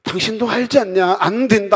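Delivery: high, loud protest. Retold or imitated. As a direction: cry out in refusal. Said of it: "했던 사람이". (높고 크게 거부하듯)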